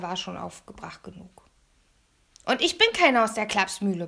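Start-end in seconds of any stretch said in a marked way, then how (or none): 2.4-4.1 loud indignant yell; angry boy shouting back; bellowing. A narrator's reading. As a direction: roar it at a closed door. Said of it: "brüllte er".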